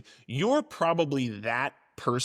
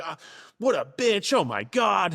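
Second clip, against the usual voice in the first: higher, scandalized scoff; mock outraged commenter; 6 semitones higher than usual. Mocking an appalled commenter, lightly scandalized scoff. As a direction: scoff like a shocked internet commenter. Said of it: "some people were like".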